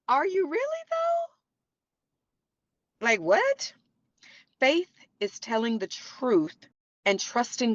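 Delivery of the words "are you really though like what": high, drawn-out doubting question; skeptical disbelief. Retold or imitated. Friend doubting an 'I'm good' reply, high and incredulous. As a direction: sound like you don't buy it at all.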